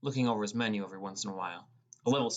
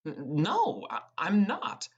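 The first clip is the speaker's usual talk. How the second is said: flustered denial; 8 semitones higher, 4 dB louder, much slower than usual. Acted. Narrator caught staring, denies it.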